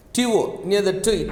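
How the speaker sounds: ordinary delivery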